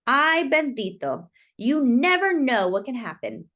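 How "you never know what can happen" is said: plain reading voice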